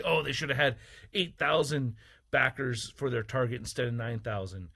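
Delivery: ordinary delivery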